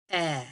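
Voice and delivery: "Eh" held with mouth open; soft open "Eh"; short e sound. Shown as a demonstration. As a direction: model the open "Eh". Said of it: "is my mouth open the whole time I say the sound".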